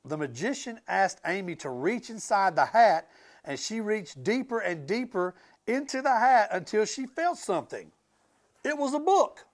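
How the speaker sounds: lively storybook read-aloud; animated picture-book narration; reading to children. A narrator's reading. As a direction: read it to a class of kids.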